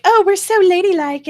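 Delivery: high, loud prissy lady put-on; mock ladylike voice. Mocking the anti-suffrage women, high and loud ladylike. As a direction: play it dainty and mocking. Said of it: "they were trying to be all like".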